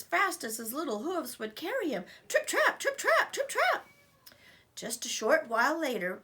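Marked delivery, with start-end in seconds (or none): 2.3-3.9 very high tiny hoofbeats; high quick trip trap. A narrator's reading. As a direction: patter it high and light. Little goat running away.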